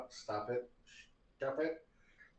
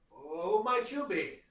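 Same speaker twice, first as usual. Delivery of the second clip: halfling shopkeeper; 6 semitones higher, slower than usual. Acted.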